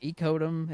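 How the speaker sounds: "Ecodum" said as ee-KOH-tum; "Ecodum" as "ee-coat-um"; added T sound. Shown as a demonstration. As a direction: slip a T into "Ecodum", long EE and OH. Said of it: "if you throw in the T".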